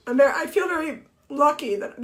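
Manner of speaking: usual speaking voice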